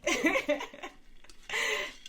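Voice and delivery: high, rising giggle; sheepish laugh. Real, not acted. Hoping neighbor will hear her, sheepish giggle. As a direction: giggle at your own hope.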